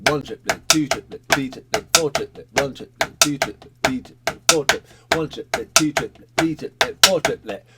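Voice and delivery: rhythmic chant, each number leading its triplet; brisk triplet counting. Shown as a demonstration. Drum teacher counting along with the pattern, brisk triplet counting. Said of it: "Do a bit slow".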